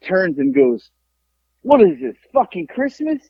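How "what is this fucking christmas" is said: higher-pitched heckle, imitating the biker; biker's mocking taunt. Biker sizes up the group in the bar, mocking taunt. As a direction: jeer at them like a barroom heckler.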